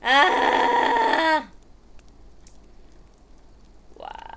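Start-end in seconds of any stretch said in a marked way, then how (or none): 0.0-1.5 sudden loud cry of frustration; frustrated yell. Real, not acted. Streamer fighting stream setup, a loud frustrated yell. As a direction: let out an exasperated loud shout.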